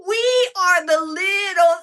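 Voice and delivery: high, loud, drawn-out cry of joy; excited shout. Real, not acted. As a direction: yell it out proudly, slowly.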